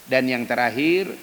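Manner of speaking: usual speaking voice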